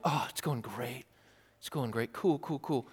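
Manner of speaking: a quiet mock "all good" dodge; breezy deflection. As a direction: shrug the question away. Mock dialogue, deflecting answer.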